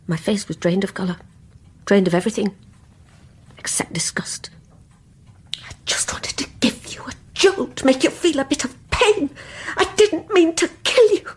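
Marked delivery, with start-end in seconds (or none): 3.6-7.1 hushed, secretive whisper. Acted. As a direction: let it slip out under your breath. Secret confession.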